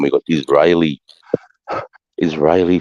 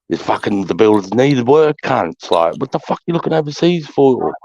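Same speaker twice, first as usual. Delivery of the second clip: exasperated rant; 7 semitones higher than usual. Real, not acted. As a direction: voice climbing higher as he rants at overseas hiring. Ranting about hiring foreign builders.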